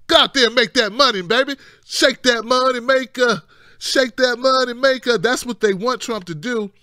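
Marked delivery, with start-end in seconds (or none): 0.1-5.2 high, loud egging-on; lightly acted backer's cheer. Backers telling Trump what they want, high loud egging-on. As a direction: push them on, high and punchy. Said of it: "no, they're like".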